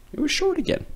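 high, light reveal; high, delighted telling; high surprise. A narrator's reading. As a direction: squeak the happy ending. Nose shrinks back.

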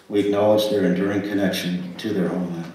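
ordinary talk, nothing marked

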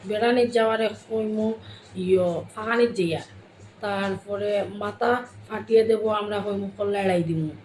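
ordinary talk, nothing marked

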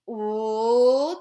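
long, loud, drawn-out ooh; teasing. A narrator's reading. Mauricio teasing Joy about a crush, teasing. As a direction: taunt with one stretched-out, swelling ooh. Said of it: "teased Mauricio".

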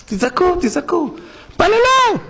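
high taunting call to cry out; mock urging. Preacher voicing the evil inclination, high mocking urging. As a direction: egg them on to wail, rising.

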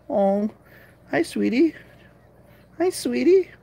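high, sing-song pet voice; baby talk to cats. Acted. Owner cooing to her cats, high baby talk. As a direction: coo at the cat like a baby.

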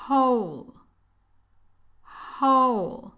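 Whole with a soft breathy h and long o. Shown as a demonstration. Teacher drilling Whole with a soft h. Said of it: "se pronuncia como una J, una J muy suave".

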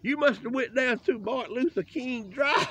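loud joke, laughing through it; laughing shout. Real, not acted. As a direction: crack up while shouting the joke.

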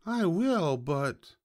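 high piglet voice, dropping on the last word; stalling piglet. A narrator's reading. Piglet stalling the hungry fox, high and resigned. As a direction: give in high, then hesitate. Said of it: "sighed the piglet".

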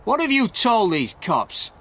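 high, slow accusing demand; angry shout. A narrator's reading. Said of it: "Stewart shouted angrily at Frank".

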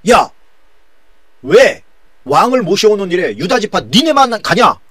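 loud, high-pitched acted protest; quarrelsome shouting. Northern tribes confronting Judah over the king, quarrelsome shout. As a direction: argue it hotly, pitched well up. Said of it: "막 그러면서 거기서 논쟁이 붙은 거예요".

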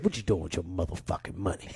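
Dick Gregory impression. Comedian retelling his elder grilling him about money, low and gruff. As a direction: drop way down and lean on him like a stern elder. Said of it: "he goes".

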